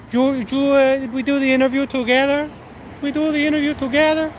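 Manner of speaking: very high mock caller voice; manager impression. Manager reminding applicant of the interview, high mock voice. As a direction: imitate her insisting, pitched right up. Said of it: "He goes".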